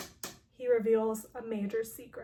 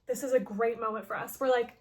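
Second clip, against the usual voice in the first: ordinary talk, nothing marked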